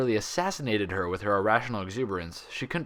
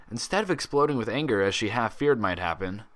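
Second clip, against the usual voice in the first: plain reading voice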